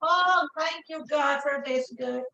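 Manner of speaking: ordinary talk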